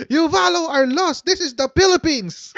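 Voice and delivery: high hammed-up angry scold; mock furious retort. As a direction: scold her like you're fed up. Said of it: "Galit na galit si ate". (kunwaring galit na sagot)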